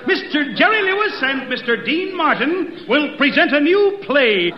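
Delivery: usual speaking voice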